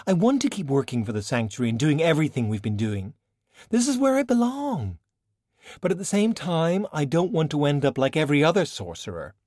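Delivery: a lightly voiced young woman, pressing her case; insisting to an older mentor; Valkyrie's earnest voice. Young sorcerer pleads with China, earnest.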